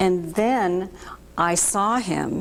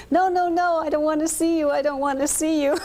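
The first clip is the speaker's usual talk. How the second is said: frightened denial; 8 semitones higher, faster than usual. Retold or imitated. Hiding under covers from ghost.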